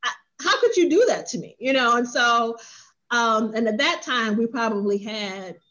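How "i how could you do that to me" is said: higher, fast mock outrage; imitating her own heated complaint; retold anger. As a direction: replay the fury at being left alone, half-laughing. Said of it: "I was hot".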